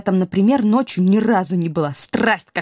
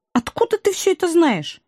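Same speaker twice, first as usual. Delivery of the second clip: higher, incredulous question; puzzled demand; 10 semitones higher than usual. Acted. Woman questions how he knows all this, puzzled. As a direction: press them with a high, puzzled question. Woman challenges the man's knowledge.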